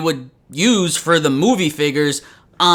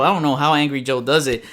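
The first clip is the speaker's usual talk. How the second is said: usual speaking voice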